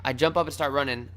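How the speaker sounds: normal talking voice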